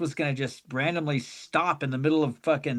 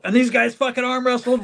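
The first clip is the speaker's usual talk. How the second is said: normal talking voice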